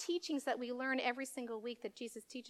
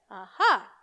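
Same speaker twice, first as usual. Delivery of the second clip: aha; 4 semitones lower, 12 dB louder than usual. Real, not acted. Teasing kids about the secret.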